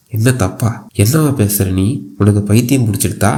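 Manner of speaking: ordinary delivery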